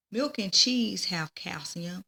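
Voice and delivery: plain reading voice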